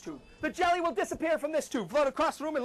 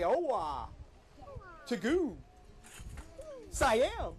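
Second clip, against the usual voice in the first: slow magic words; 4 dB quieter, much slower than usual. Shown as a demonstration.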